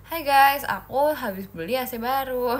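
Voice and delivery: loud, bright mock vlogger greeting; hammed-up vlogger hello; vlogger intro. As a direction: play the hyped YouTuber opening a video. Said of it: "Kayak aku ntar".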